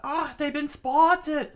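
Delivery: very high, loud, slow cry; screamed. Acted. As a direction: shriek the warning. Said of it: "Nami screamed out".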